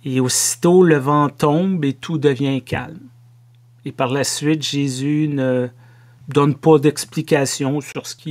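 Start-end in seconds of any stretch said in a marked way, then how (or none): none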